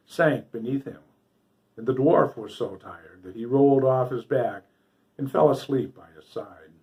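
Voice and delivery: usual speaking voice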